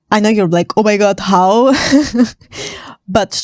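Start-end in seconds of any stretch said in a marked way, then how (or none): none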